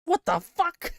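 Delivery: very high, loud squawk of outrage; shrieking judge. Acted. Judge reacting to being assaulted, shrill high shriek.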